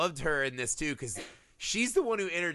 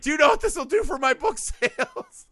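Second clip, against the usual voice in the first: very high, loud squeal; hammed-up greedy thrill, breaking into laughter; mock gleeful excitement; 13 semitones higher, 7 dB louder than usual. Imitating the reporter thrilled about book sales, squealing excitement.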